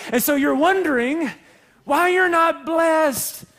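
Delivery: preaching emphasis. Real, not acted. Preacher confronting the congregation, loud slow emphasis. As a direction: drive it home to the room.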